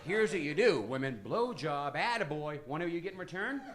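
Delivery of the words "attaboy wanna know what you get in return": usual speaking voice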